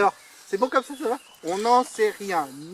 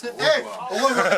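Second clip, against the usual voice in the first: slow, grand goodbye with flair; classy farewell; 3 semitones higher, slower than usual. Shown as a demonstration. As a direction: play it like a statesman leaving. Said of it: "ça c'est la classe".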